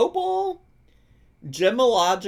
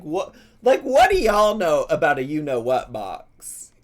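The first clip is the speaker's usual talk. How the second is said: normal talking voice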